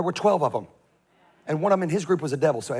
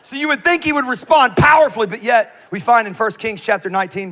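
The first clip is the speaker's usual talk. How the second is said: loud, much higher shout; preaching shout; 5 semitones higher, 8 dB louder than usual. Real, not acted. Preacher turning to the scripture, shouting. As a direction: ring it out to the hall.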